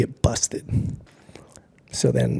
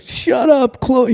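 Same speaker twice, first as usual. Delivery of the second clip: high mocking brush-off; 12 semitones higher, 4 dB louder, much slower than usual. Friend brushing him off.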